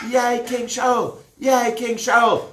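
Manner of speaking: high, happy chant; cheering. Acted. Army cheering King Shaul, high and bright.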